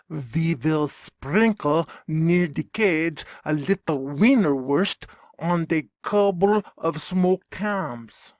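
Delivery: put-on slow, loud German-Dutch accent; a loud Dutch professor laying out the plan; Dutch doctor. Dutch doctor speaks, says mit.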